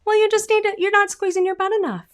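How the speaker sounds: high mocking voice. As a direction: mimic a vocal coach's cliché. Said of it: "I've heard that".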